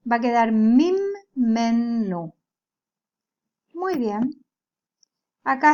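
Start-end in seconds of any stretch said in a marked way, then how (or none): 0.5-2.3 mim, men, nu split into separate beats; syllable by syllable. Shown as a demonstration. Teacher splitting mimenu into syllables for students. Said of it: "cuando la separo en sílabas".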